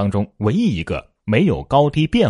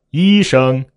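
higher, louder level tones; raised key; 4 semitones higher, 7 dB louder than usual. Shown as a demonstration. Teacher stepping the word up, raised level key. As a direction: lift both syllables a step higher. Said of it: "它都是医生".

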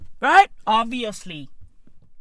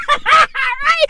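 much higher, loud laughing yell; laughing shout; 4 dB louder, slower than usual. Acted. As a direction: cackle the line out.

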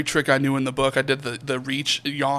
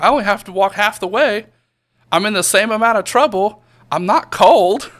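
smug boast; 6 semitones higher, 8 dB louder than usual. Retold or imitated. Boasting to dad.

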